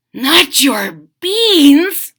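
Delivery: mock horror over the beans; dismayed cry. Skipito crying out that the beans are stolen. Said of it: "cried Skipito".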